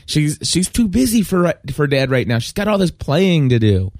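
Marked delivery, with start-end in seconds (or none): none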